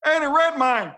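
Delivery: very high hammed-up heckle; mock outraged American. Mock angry American resenting British actors.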